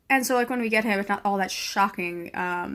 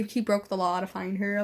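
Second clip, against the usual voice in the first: ordinary delivery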